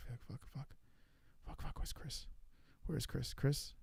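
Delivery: hushed, breathy panicked swearing under the breath; frantic whisper. Real, not acted. Streamer panicking at the quiz, whispering curses. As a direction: whisper the curses so nobody hears.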